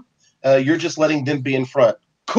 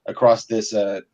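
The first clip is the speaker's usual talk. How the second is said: ordinary talk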